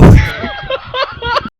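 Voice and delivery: bursts into high laughter; a sudden real laugh at his own story. Real, not acted. (खुलकर हँसी)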